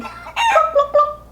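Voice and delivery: very high squawky hen clucks; crying hens. A narrator's reading. Narrator voices hens crying out in the wind, high clucking. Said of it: "the hens cried".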